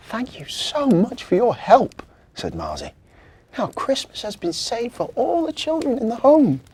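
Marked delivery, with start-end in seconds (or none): 0.1-2.0 high, louder thanks; heartfelt elf thanks; grateful elf. Acted. Marzi thanks the children for helping, high grateful. 3.6-6.6 high, louder thanks; heartfelt elf thanks; grateful elf. Acted. Marzi thanks the children for helping, high grateful.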